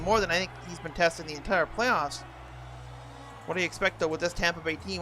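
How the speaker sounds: normal talking voice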